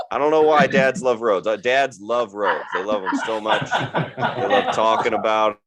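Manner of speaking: ordinary delivery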